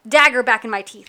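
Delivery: ordinary delivery, nothing marked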